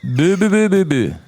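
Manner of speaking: syllabes be à la place des mots; parler en bebebe. Pretend. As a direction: ne parler qu'en be be be. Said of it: "tu peux parler en bebebe". (ne dire que des be)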